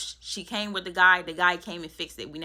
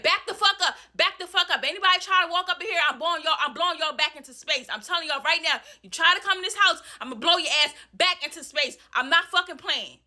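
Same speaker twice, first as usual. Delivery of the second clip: furious screaming; 7 semitones higher than usual. Retold or imitated. Armed tenant threatens visitors at the door, high furious yell. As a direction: scream them off the porch. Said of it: "I said".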